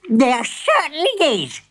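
high, indignant granny shriek; hammed-up outraged granny. A narrator's reading. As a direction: scold in a high old-granny voice. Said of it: "she shrieked".

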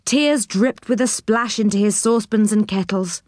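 ordinary delivery, nothing marked

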